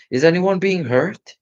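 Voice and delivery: put-on anxious boy calling out. A narrator's reading. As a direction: call into the night to find who's hurt. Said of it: "he called".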